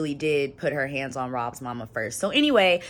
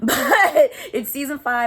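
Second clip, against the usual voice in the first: laughing; 8 semitones higher, 9 dB louder than usual. Real, not acted. Laughing at odd numbering.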